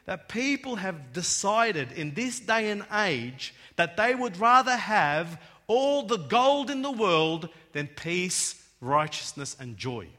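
loud, slow, driving preacher's build; preaching at full force. Acted. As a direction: hammer it home to the congregation.

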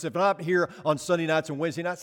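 usual speaking voice

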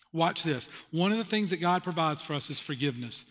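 normal talking voice

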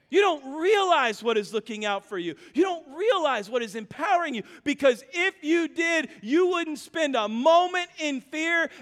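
loud, climbing sermon build; preaching at full force. Acted.

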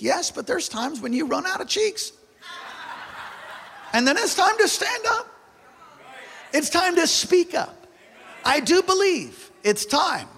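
very high, rousing preacher's cry; rally the congregation to stand up; full-force preaching. Real, not acted. Pastor urging church to speak up, high impassioned preaching.

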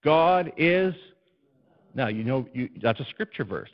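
usual speaking voice

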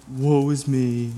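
slow, drawn-out mopey moan; mock self-pity, dragged out slowly; woeful and slow, playing up the misery; Eeyore. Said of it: "doing the whole Eeyore thing".

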